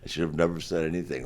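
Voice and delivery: normal talking voice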